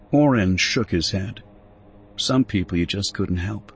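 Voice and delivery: plain reading voice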